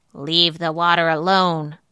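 loud monster crab warning; put-on creature voice, loud and forbidding; menacing giant crab. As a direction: warn her off as a giant crab. Giant crab warns the girl.

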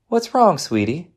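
high, lilting motherly question; concerned question. Acted. Mother first questions silent son.

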